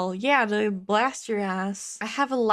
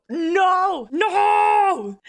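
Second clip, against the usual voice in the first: high loud shout of no; real shout; 8 semitones higher, 7 dB louder than usual. Real, not acted. Reacting to his age reveal with a loud high shout. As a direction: yell it out in shock.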